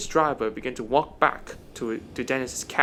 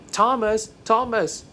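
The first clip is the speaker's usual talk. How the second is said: higher-pitched call after someone; 7 semitones higher, 3 dB louder, slower than usual. Acted. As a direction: call out the name urgently, drawn downward. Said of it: "Dennis shot out".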